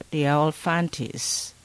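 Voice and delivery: ordinary talk, nothing marked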